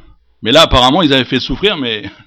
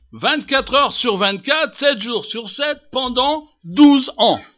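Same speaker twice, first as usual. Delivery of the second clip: high, measured preaching with slow weight; emphatic preaching; 10 semitones higher, slower than usual. Acted. Preacher marvelling at twelve years of illness, emphatic. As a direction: drive home the duration in a high pulpit voice.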